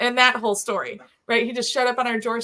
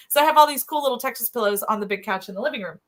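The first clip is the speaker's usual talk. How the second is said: ordinary talk, nothing marked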